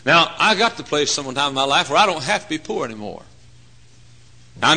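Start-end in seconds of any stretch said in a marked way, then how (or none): none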